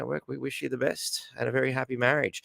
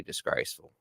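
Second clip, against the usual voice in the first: softer, breathless disgraceful; disgraceful under the breath; muttered disgust; 8 dB quieter than usual. Acted. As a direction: let disgraceful drop to a disgusted hush. Ranting about media onslaught.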